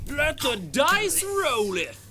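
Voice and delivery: high, slow archaic battle cry; mock epic shout. Player echoing the GM's catchphrase as a hammed-up high battle cry. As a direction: shout it like a grand herald, slowly.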